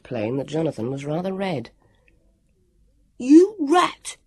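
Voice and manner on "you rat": high, loud outraged cry. A narrator's reading. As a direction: yell it in indignation at the friend. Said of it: "Sam cried out".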